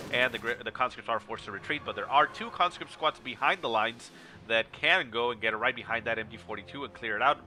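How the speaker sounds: normal talking voice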